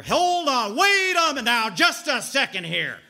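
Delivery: loud, high interrupting shout; interrupting aunt. Retold or imitated. Aunt bursting from the kitchen, shouting loud and high. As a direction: burst in and stop everyone cold.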